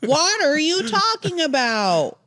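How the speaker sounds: very slow, high-pitched question; exaggerated pun delivery. Co-host repeating a water gun pun, high and drawn out. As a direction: stretch each word out, pitched well up.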